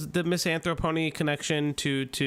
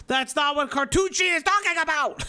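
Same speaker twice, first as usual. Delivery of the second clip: raised, high-pitched exasperation; exasperated outburst; 8 semitones higher than usual. Real, not acted. Host correcting someone over who Cartoon was talking about, exasperated. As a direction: build to an exasperated shout.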